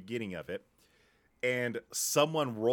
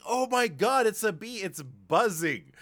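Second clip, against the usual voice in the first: high, loud excited gasp; thrilled squeal over a bee; 8 semitones higher, 7 dB louder than usual. Retold or imitated. Retold moment of finding bee.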